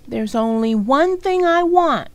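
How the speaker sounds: loud, high, put-on kid voice insisting; little sister insisting on her price. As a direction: dig in your heels. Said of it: "D W insisted".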